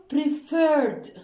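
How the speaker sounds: "preferred" with a stressed, drawn-out second vowel; stress on second syllable. Shown as a demonstration. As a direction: lean hard on the last syllable of "preferred". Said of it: "We stressed on what?".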